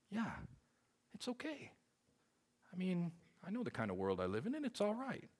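high, small self-soothing voice; self-excusing. Retold or imitated. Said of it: "I took that and I said".

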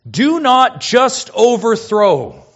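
loud, high, slow command; forceful preacher's emphasis, drawn out; emphatic. Shown as a demonstration. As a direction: hammer out each word of the command. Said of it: "Emphasis just screams out. It's emphatic".

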